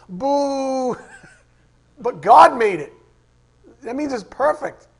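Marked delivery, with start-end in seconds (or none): 0.1-1.0 mock boo. Mocking crowd boos God.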